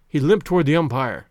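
plain reading voice

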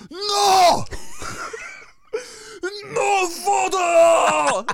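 high furious shout; screaming. Retold or imitated. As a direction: yell it like an angry old man. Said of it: "Just screaming at me".

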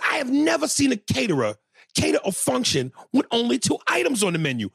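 mock indignant yell. Comic ribbing the caterer about two menu items, mock indignant yell. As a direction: ham up disbelief at the tiny menu.

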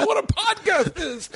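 high, slow words squeezed out through laughter; repeating the joke while laughing hard. Real, not acted. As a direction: crack up as you repeat the punchline. Echoing friend's punchline, cracking up.

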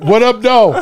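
loud, high catchphrase holler; big whooped hello; Detroit call-out. Shown as a demonstration. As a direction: shout the greeting across the street, laughing. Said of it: "everybody knows from Detroit".

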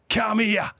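called out high across a room; calling over. Retold or imitated. Duck calls him over.

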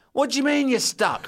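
high, sneering teacher voice; mock him from below. Teacher mocking the stuck pupil, high and sneering. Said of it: "Mister Kendall's going".

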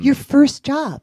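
loud, slow, astonished question; amazed. Real, not acted. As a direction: react in real disbelief, stretching the words. Host amazed at the story's outcome.

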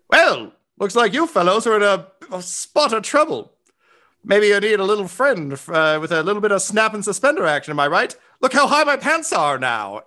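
high-pitched folksy cartoon helper; Gatsby. Gatsby offering help to fellows in trouble, high jaunty voice. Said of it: "At which point you hear".

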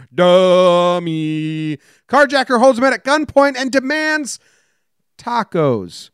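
slow, deliberate newscaster read with deadpan punchlines; news read. Acted. Host reading odd news headlines to his co-host, newscaster style. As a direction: read the headlines like an evening anchor.

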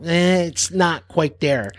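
mock picky producers; producers unimpressed. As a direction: lazily wave off the take. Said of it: "and they go".

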